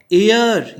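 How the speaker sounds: "एयर" punched out loud; stressed word. Shown as a demonstration. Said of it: "ये stress हुआ".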